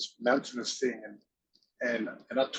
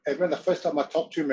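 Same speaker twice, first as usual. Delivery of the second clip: usual speaking voice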